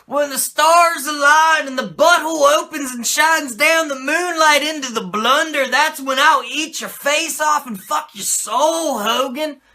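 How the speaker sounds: high, loud hammed-up wrestling villain ranting; over-the-top evil promo voice; mock villain promo. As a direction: boom it out like a cult leader on the mic. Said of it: "talks all like".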